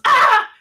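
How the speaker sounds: very high, loud yelp; mock startled yelp. Eisner startled by Baby, loud high yelp. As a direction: yelp as if caught off guard. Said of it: "And Michael goes".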